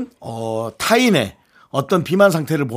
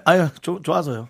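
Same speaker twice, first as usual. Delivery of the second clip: normal talking voice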